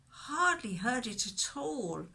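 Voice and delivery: usual speaking voice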